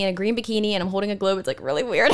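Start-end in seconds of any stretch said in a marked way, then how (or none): none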